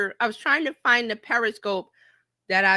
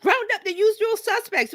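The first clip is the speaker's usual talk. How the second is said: Casablanca quote; 11 semitones higher than usual. Retold or imitated. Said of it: "that scene from Casablanca".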